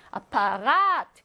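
"아파가트" with the last A held long; slow German "아파가트", long stressed vowel. Shown as a demonstration. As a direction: stretch the "가" in "아파가트". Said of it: "얘는 길게 발음한다".

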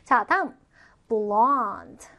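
ordinary talk

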